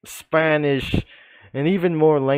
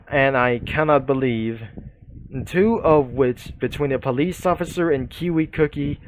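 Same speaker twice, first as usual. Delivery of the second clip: normal talking voice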